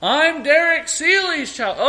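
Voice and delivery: put-on high, slow child's holler; child calling back. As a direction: call out who you are like a kid far away.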